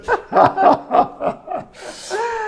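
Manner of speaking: high, long burst of laughter. Real, not acted. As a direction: cackle at his own prank story. Big laugh after prank story.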